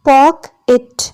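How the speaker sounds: "pocket" split in two, pock, et; syllable by syllable. Shown as a demonstration. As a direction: say "pocket" with a pause between its syllables. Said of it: "there are two syllables". ("pocket" को दो हिस्सों में तोड़कर)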